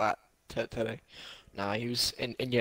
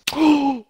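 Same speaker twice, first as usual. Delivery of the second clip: loud, high-pitched gasp; sharp gasp; 14 semitones higher, 8 dB louder than usual. Real, not acted. Reacting to the game mid-stream, loud sharp gasp. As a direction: suck in air sharply, loudly.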